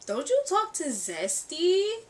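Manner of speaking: slow, high, loud retold challenge; pointed question. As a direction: draw the question out, rising at the end.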